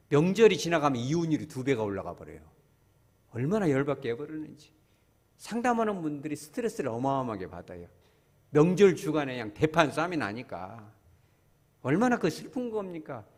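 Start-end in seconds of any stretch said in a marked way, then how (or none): none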